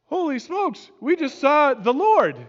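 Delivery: high, loud cry of amazement; awestruck exclamation. Retold or imitated. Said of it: "And they're like".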